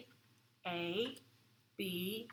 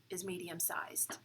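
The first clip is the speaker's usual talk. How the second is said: whisper; 2 semitones lower, 8 dB quieter than usual. Real, not acted. Answer dropped to a hush.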